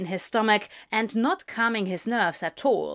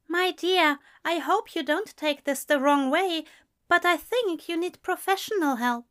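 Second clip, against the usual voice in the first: higher-pitched, gently concerned voice; 8 semitones higher than usual. A narrator's reading. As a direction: break it to a dear friend, high and careful. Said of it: "looked at him with concern".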